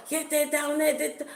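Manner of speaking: high-pitched excited egging-on, cut short; high mock hype; imitating hyping up. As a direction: mimic an eager bystander urging on a chase. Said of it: "Like, hyping up the girl".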